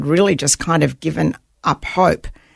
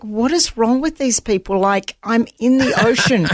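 higher, amused indignation at the rescuers; mock outrage with a laugh; exasperated disbelief; 6 semitones higher than usual. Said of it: "I'm like".

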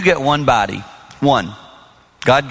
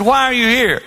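pointed question; 11 semitones higher than usual. Retold or imitated. Pastor confronts divorced friend at the gym, pointed question. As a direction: ask him pointedly why he's there. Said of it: "I said".

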